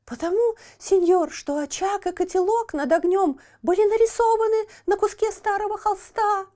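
put-on high lamenting child voice; pitiful puppet boy. A narrator's reading. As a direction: plead in a high thin voice. Buratino voiced in story.

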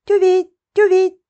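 high, loud bird chirping; cartoon bird voice. A narrator's reading. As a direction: chirp it like a tiny tit. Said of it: "пропела она". (высокий птичий щебет)

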